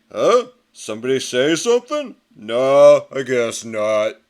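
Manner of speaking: a loud startled huh, then a slow shrug; the tough guy who felt nothing; unbothered brute. Acted. Pig Iron shrugging off a car.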